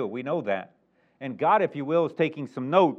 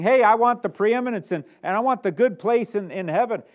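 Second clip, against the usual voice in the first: higher, louder eager demand; disciples demanding; 8 semitones higher, 7 dB louder than usual. Retold or imitated. Disciples asking Jesus for preeminence, eager higher voice. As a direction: grab for the best seat, pushy and keen. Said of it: "they're saying".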